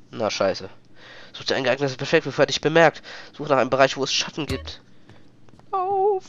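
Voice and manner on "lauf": high sudden run cry; panicked command to run; urgent. Real, not acted. Spotted, urging run.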